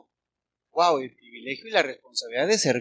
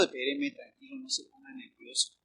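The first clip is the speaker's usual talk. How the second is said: soothing him; 7 semitones higher, 9 dB quieter than usual. Pretend. Mock-soothing the angry man.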